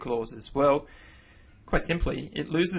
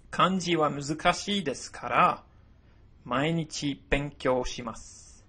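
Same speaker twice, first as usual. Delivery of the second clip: model sentence read; 2 semitones higher than usual. Shown as a demonstration.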